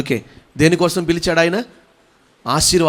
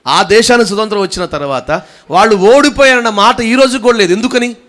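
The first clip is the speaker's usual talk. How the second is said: raised, louder proclamation; emphatic preaching; 6 semitones higher, 7 dB louder than usual. Real, not acted. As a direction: lift the voice to press the point. Preaches at full force. (గొంతు పెంచి నొక్కి ప్రసంగం)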